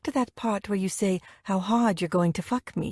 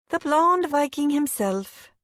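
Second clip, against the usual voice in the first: gushing; 7 semitones higher, 3 dB louder than usual. Acted. Zoe gushing over Brad.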